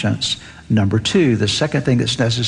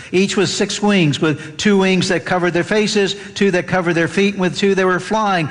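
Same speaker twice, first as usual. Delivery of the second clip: higher-pitched pulpit scripture reading; 7 semitones higher than usual. Acted. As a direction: read the passage aloud with reverence. Scripture reading continues after aside.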